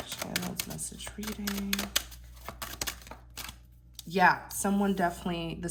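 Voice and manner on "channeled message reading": quiet murmur. Real, not acted. Murmured while shuffling again.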